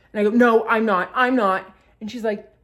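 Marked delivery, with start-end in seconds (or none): 0.4-1.7 loud, raised defensive denial; insistent protest. Retold or imitated. Daughter denying to mother she's tweaking, defensive. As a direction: push back insistently.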